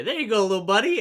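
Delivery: high, loud coaxing lilt; mock kindly send-off. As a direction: coo it up high to a tiny buddy. Mock kindly talk to tiny mouse.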